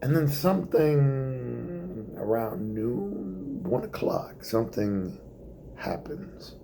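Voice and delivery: ordinary delivery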